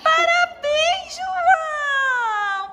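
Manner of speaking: high, slow, sing-song congratulations; playful praise. Pretend. Mother praises the toy patient for taking medicine, high and drawn out. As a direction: cheer the brave patient on.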